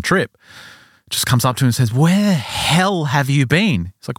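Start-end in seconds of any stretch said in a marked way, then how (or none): 1.9-3.9 slow, higher-pitched indignant demand; acted-out exasperated confrontation; confronting. One of the boys confronting the returning father, indignant.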